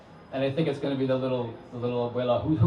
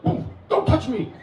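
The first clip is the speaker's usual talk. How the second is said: much higher, louder mock warning; big man's warning; 14 semitones higher, 4 dB louder than usual. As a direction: bark it like a startled big man. Big man snaps at narrator.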